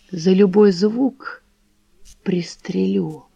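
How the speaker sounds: put-on slow, breathy old man's whisper; whispered threat. A narrator's reading. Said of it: "прошептал он".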